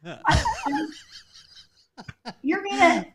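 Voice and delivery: high, amused mock-incredulous voice; laughing God. God laughing at the group leader's prayer, amused and high. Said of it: "I can just hear God's laughter".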